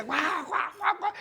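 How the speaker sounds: high yapping barks; mock barking. Students bark at the teacher, high mock yaps. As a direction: bark like a little dog to tease.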